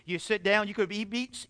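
usual speaking voice

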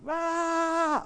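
high, loud, sustained mock scream; imitated scream. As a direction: mimic her long scream. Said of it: "The lady starts screaming".

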